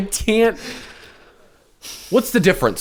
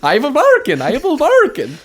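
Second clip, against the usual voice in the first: high mock Swedish accent; hammed-up Swedish voice; 10 semitones higher, 3 dB louder than usual. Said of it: "Can we give him a Swedish accent?".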